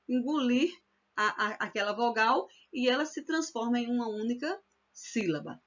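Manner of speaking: ordinary talk, nothing marked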